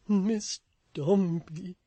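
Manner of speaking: slow, solemn, loud address; grave and nervous; awful voice. A narrator's reading. Toots addressing Florence in an awful solemn voice. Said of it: "said Mister Toots in an awful voice".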